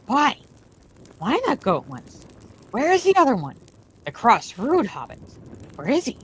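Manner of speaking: wary creature voice asking questions; sniffing out a trick; suspicious creature. A narrator's reading. Gollum asks suspiciously.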